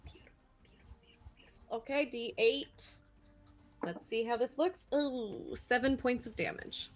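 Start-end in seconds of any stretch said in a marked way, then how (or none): 0.0-1.8 whispering. Real, not acted. Delighted at the hit roll.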